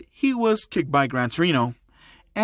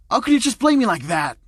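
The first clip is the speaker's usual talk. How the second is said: higher, louder indignant protest; outraged; 7 semitones higher, 4 dB louder than usual. A narrator's reading. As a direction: protest loudly at the unfair blame. Katsuki outraged at being blamed.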